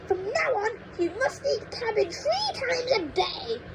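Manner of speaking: put-on high, cranky old woman lecturing; cranky grandma. Grandma scolds George about growing, in a high cranky voice. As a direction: scold like a mean little granny.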